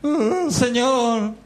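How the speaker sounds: high, drawn-out imitation of wailing; tearful plea to God, exaggerated and high; mock weeping. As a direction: stretch it out like a hammed-up lament. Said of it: "ahí estaba Samuel llorando".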